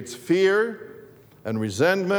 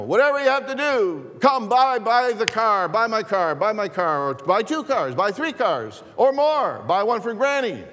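pushy car salesman; 7 semitones higher than usual. Retold or imitated. Pastor mimicking the car ad's hard sell, high and pushy. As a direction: push the car on them like a TV huckster. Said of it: "came across real strong like that".

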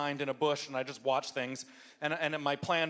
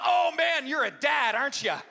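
put-on teasing kid; 8 semitones higher, 6 dB louder than usual. Ringleader kid teasing the dad.

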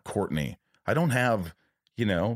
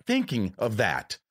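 ordinary talk, nothing marked

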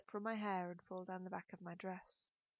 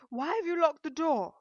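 high, loud repeated demand; demanding shout; 9 semitones higher, 14 dB louder than usual. A narrator's reading. Mother locked out by her daughter, shouting insistently. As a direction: shout the question through the door.

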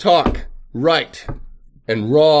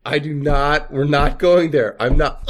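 normal talking voice

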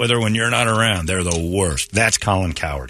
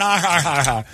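high, spelled-out fake laugh; mock audience laughter; 5 semitones higher than usual. Audience laughs at the movie, mockingly imitated. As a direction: imitate a crowd laughing along. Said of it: "And we're all laughing".

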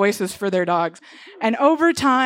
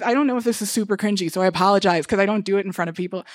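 ordinary talk

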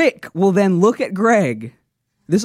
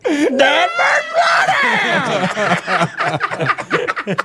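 mock Greg shout; 8 semitones higher than usual. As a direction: shout it high and silly. Repeating Greg's line.

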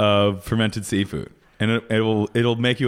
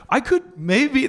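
hopeful; 13 semitones higher, slower than usual. Retold or imitated. Pleading to agent about age.

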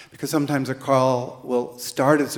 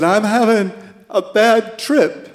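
put-on higher, louder voice of a tripping teenage girl; teen girl on a bad trip; 7 semitones higher, 6 dB louder than usual. Teen caller telling the hotline volunteer she's tripping, higher and louder. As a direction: play the girl calling the hotline. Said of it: "And she said".

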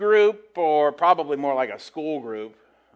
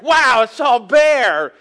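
slow, high, loud gasp of wonder at a bear; awestruck tourist; 10 semitones higher, 12 dB louder, much slower than usual. Retold or imitated. Tourist up north voice marveling at a bear, slow and high. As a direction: gush like a northerner seeing a bear. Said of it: "It's like".

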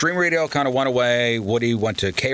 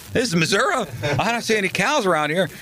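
bigger mock amazement; 10 semitones higher than usual. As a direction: push "Missouri" way up in playful awe. Repeating with bigger mock wonder.

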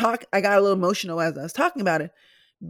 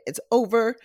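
ordinary talk

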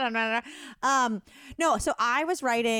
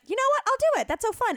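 very high, bright excited squeak; chirpy eager voice; 13 semitones higher, 3 dB louder, faster than usual. Retold or imitated. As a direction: gush like an overeager friend. Retold eager reply to friend.

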